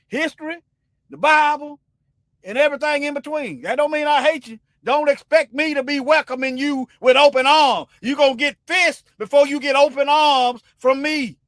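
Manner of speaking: high, loud defiant shouting; angry shout. Real, not acted. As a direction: shout the warning, fists up. Heated rant continues, shouting.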